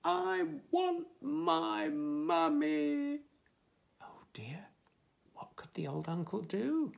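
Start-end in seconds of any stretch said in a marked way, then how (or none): 0.0-3.2 very slow, high storybook wail; crying out. A narrator's reading. Baby in the rain wants a mummy, long drawn-out cry. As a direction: stretch every word into a long high cry. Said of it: "he cried".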